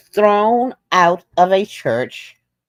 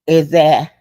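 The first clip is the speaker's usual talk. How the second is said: usual speaking voice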